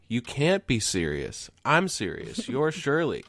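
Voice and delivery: half-voiced retold shout; retold scream back. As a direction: imitate yelling back, pitch climbing to the end. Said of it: "And I screamed back".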